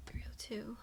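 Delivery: soft unvoiced whisper. Real, not acted. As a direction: breathe the words with no voice. Whispering the time to herself.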